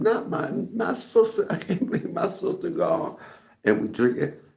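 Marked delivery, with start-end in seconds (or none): 0.0-3.2 high wailing. Retold or imitated. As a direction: wail it out in mock grief. Tipsy wailing, second take.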